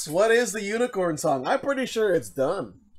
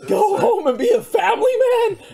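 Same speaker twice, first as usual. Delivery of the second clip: laughing quote; 12 semitones higher, 6 dB louder than usual. Retold or imitated. Quoting game loss line, laughing.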